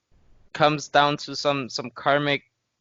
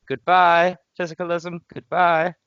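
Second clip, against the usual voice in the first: higher-pitched, punchy goodbye; mock farewell; 6 semitones higher, 3 dB louder than usual. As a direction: bid it a mock farewell in a raised voice.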